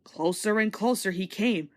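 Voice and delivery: usual speaking voice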